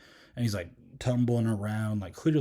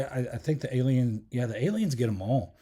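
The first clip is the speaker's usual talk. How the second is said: usual speaking voice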